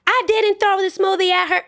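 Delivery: high, indignant fake innocence; imitating a lying coworker; mock denial. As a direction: deny it in a high mocking voice.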